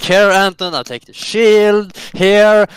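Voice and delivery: lightly acted loud offer; calling out in role. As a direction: call it out high and loud, playfully. Player hands weapons to guard.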